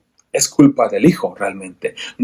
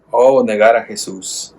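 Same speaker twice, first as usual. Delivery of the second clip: ordinary talk, nothing marked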